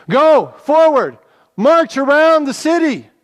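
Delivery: high, loud marching order; commanding shout. Acted. Joshua ordering the people forward, a loud high command. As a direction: call the troops forward.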